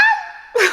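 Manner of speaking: shrill burst of a squealing laugh; high excited laugh. Real, not acted. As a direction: let a giddy squeal of a laugh out. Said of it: "Why am I talking like that?".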